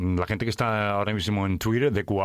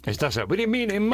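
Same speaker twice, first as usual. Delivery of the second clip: silly mock voice; 11 semitones higher than usual. Teasing her jittery state.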